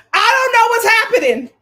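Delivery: loud, high burst of disbelief; baffled outburst. Real, not acted. Host reacting to Clark and Coco picking Taylor, baffled outburst. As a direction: belt it out, utterly baffled.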